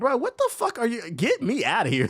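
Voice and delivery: high-pitched, laughing-edged protest; mock outraged disbelief. Customer reacting to phone store workers' talk, mock outrage. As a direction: act out balking at them, pitch way up.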